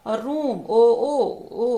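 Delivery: ordinary talk, nothing marked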